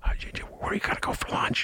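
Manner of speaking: stammered whisper into an ear. Acted. Adviser whispers to the mayor at city council, whispering. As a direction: whisper confidentially, fumbling the opening. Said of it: "whispers in the Lori Lightfoot's ear".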